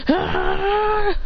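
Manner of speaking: high, drawn-out charging cry; imitated anime attack scream; battle yell. Character charges into fight.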